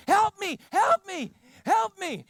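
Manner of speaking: mock panicked kid, high and loud; hammed-up cries of help me, high and loud; imitating the kid. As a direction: play the grinning kid yelling for help. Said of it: "and he just goes".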